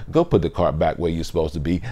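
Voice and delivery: low, very soft, quick nudge; inner voice. Acted. Conscience nudging a shopper, quiet inner voice. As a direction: murmur a quiet prompt of conscience. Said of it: "and you hear".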